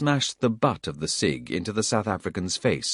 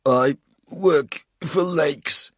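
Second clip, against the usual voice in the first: higher, slow, strained; pained confession; 9 semitones higher, 5 dB louder, slower than usual. A narrator's reading. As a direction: force it out in pain. Coles confesses in pain.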